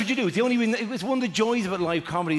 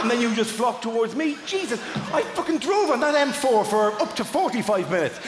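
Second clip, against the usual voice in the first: high, indignant comic rant; mock exasperation; 8 semitones higher, 3 dB louder than usual. Comedian who drove the motorway to his audience, mock exasperated. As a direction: rail at the wasted effort, pitched up. Mock outrage over needless driving.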